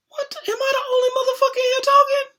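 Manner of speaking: high, fast, outraged yell; outraged kid. Retold or imitated. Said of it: "I was like".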